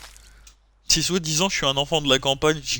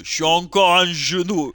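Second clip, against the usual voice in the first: ordinary talk, nothing marked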